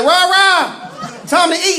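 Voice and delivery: mother calling her son to eat, a high singsong call. Retold or imitated. Mother calls through door.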